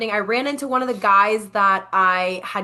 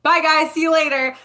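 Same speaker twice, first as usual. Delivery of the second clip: higher, louder call-out goodbye; breezy farewell; 7 semitones higher, 5 dB louder than usual. Retold or imitated. Leaving friends at camp in her story, breezy called farewell. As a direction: call it out on your way off.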